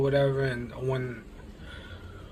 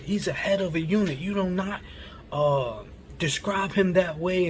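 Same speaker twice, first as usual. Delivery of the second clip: higher-pitched imitation of a telling-off; retold scolding; 5 semitones higher than usual. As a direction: lay down the rule, mock-indignant and high. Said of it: "like, yelling at me".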